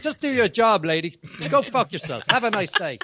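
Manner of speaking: high-pitched Boston telling-off; blunt Boston accent. Retold or imitated. Replaying the passenger's outburst at the driver, Boston accent. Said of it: "He just came straight at".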